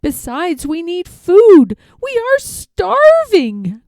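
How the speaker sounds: high, strained pleading, rising to a cry; a crushed turtle groaning up to the king; groaning plea. A narrator's reading. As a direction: beg the king from under the stack. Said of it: "groaned Mac".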